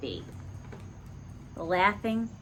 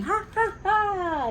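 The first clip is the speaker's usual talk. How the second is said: high, bright ha ha ha; laughing; 11 semitones higher, slower than usual. A narrator's reading. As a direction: burst out laughing. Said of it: "And they're laughing".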